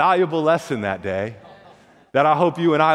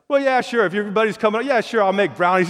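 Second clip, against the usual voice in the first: higher, breezy mom saying yes; brightly agreeing, pitched up; cheery mom; 5 semitones higher than usual. Acted.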